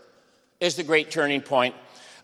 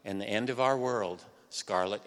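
a touch of weary gloom, slightly softer; weary intoning; 5 semitones lower, 7 dB quieter than usual. Retold or imitated. Lecturer quoting Ashley telling Scarlett, mildly weary and mopey. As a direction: sigh it out like a mopey hero. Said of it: "he intones wearily".

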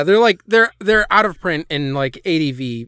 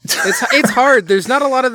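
laughing; 8 semitones higher, 4 dB louder than usual. Real, not acted. Laughing through failed baby voice.

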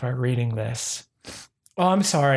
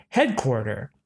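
ordinary talk, nothing marked

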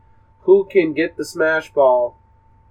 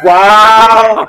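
mock excited shout; 5 semitones higher, 8 dB louder than usual.

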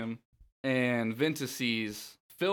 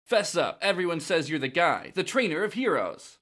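put-on keen young hero, higher and louder; Zack; 5 semitones higher, 4 dB louder than usual. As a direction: press the trainer to admit it. Acting out Zack's lines.

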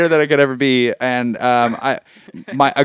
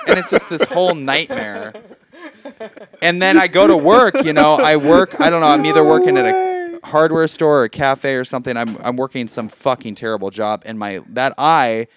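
ordinary delivery

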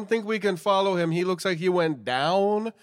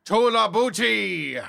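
gruff pirate shout; pirate cry; 3 semitones higher, 5 dB louder than usual. Acted. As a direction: bellow it like a pirate captain. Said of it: "pirate man".

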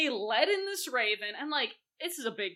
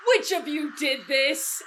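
loud, higher, slow accusation; outraged host; 5 semitones higher, 7 dB louder, slower than usual. Acted. Host accusing his dinner guests, outraged. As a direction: demand it of the whole room, word by word. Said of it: "and my host going".

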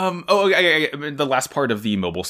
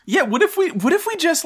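mock pitch. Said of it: "echoing cohost's mock pitch".

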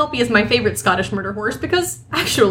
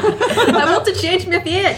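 high, slow, hammed-up Scottish accent; 9 semitones higher, slower than usual. Host joining the joke, high mock Scottish accent. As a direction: overdo a Scottish movie-heroine cry through laughter. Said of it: "do my horribly offensive Scottish accent".

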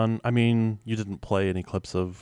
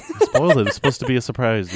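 loud, raised-pitch playful protest; mock outrage; 5 semitones higher, 10 dB louder than usual. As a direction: scold a co-host for blowing the surprise, jokingly. Playful protest at spoiled surprise reveal.

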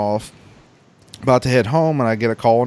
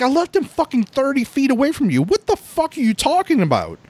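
disbelieving yell; 13 semitones higher than usual. Retold or imitated. Yelling at sister-in-law that he left dad close by, furious disbelief. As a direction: shout it like you can't believe your ears.

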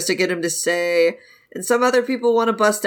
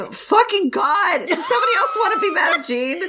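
laughing exasperation; 9 semitones higher than usual. Real, not acted. Breaking character, exasperated laugh.

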